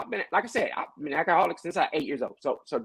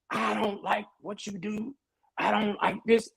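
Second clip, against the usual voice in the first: voiced complaint; 7 semitones higher, 5 dB quieter than usual. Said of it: "we say that, we'll say".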